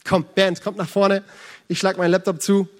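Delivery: normal talking voice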